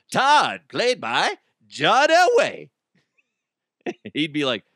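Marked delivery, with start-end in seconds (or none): 0.1-2.7 higher, louder, slow movie-credit announce; mock announcer. Host hyping a fake TV cast, in a grand announcer voice. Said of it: "mock announcer intro".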